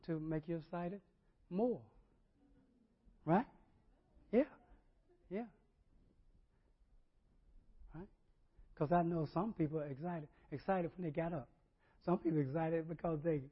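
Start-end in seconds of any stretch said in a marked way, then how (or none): none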